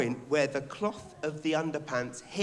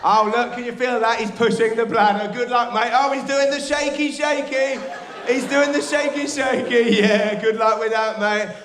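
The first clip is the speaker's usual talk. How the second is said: loud, high play-by-play of his own pee; hammed-up sports commentary; mock excited commentary; 6 semitones higher, 8 dB louder than usual. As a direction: cheer it on like a match.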